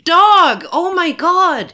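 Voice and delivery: high, loud yell at the dog; real high-pitched scolding outburst; exasperated shout. Real, not acted. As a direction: cry out in exasperation. Calls out at misbehaving dog.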